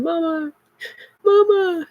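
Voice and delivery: put-on high wailing child's cry of Mama; imitating crying son. Mother voicing her son crying for her, high wail. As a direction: wail it like a crying little boy. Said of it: "my son over here bawling".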